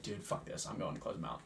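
low, very soft aside; muttered resolve. Retold or imitated. Bartender decides to step in, muttered low. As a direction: mutter it to yourself, dropping away.

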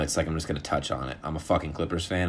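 usual speaking voice

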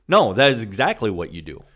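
normal talking voice

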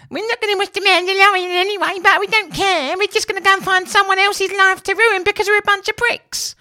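very high, loud squeaky mock voice; shrill cartoon parody of a ranting troll; mocking the woke mob. Podcaster parodying how Twitter ranters sound, squeaky and loud. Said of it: "This is how they speak".

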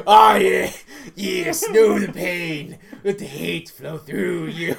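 slow, gloating dark-side voice; mock evil tempter. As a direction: tempt the kid mockingly. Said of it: "I'm like".

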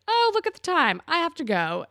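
high, loud mock excuse; acted-out awkward exit line; hasty excuse imitation. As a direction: exclaim a phony reason to leave. Dylan's excuse to leave dinner.

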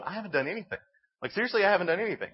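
acting out a wrongly accused man; mock protest. Acted protest of accused blind man.

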